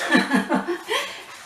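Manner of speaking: high amused laugh. Real, not acted. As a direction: laugh at yourself. Laughs at own forgetfulness.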